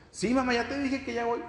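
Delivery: put-on high, dismissive kid voice; lazy child reply. Son brushes off his mother, high lazy kid voice. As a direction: brush off mom like a child who won't come. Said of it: "el hijo te escucha".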